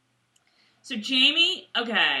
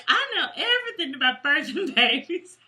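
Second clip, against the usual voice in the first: higher, lightly laughing mock boast; mock know-it-all; 7 semitones higher than usual. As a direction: play up the know-it-all, amused. Voices Claire's boast, mocking.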